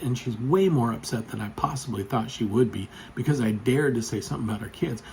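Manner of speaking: normal talking voice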